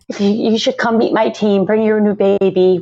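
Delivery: ordinary talk, nothing marked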